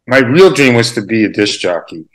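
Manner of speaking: ordinary talk, nothing marked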